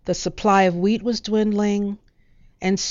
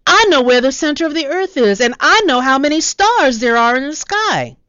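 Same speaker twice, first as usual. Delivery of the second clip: loud, fast, higher blurted boast; outburst; 7 semitones higher, 7 dB louder than usual. A narrator's reading. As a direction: let it burst out of him. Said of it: "In an outburst".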